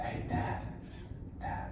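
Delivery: whisper. Retold or imitated. Whispering to dad on stage.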